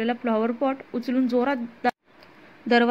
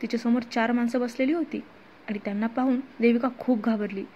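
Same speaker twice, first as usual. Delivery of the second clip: usual speaking voice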